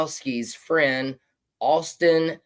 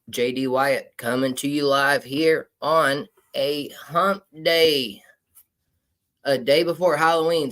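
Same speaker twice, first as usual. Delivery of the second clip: ordinary talk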